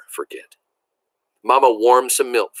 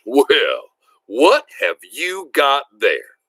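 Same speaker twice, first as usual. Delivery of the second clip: a hint of a booming father, slowed down; a big, slow fatherly voice, lightly voiced; much slower than usual. A narrator's reading. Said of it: "The booming voice of my father".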